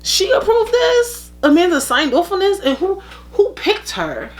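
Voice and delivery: can't believe what you're hearing; disbelief. Retold or imitated. Fan reacting to Amanda joining the show, incredulous. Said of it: "I was like".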